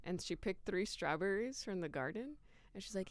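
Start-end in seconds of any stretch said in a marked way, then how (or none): none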